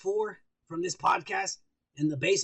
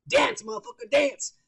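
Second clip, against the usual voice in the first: whip-cracking yell; 5 semitones higher, 4 dB louder than usual. Retold or imitated. Kennedy cracking a whip on Ford.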